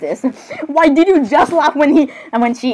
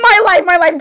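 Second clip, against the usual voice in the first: high, dramatic cry of disbelief; lightly squealed fangirl panic; overwrought outburst; 6 semitones higher, 3 dB louder than usual. Acted. Dramatic outburst in acted skit.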